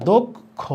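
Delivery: "দক্ষ" split as "dok-kho", high pitched; closed o vowel. Shown as a demonstration. Teacher applying the o-sign, "দক্ষ" as high "dok-kho". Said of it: "এখানে দিতে হবে ওকার".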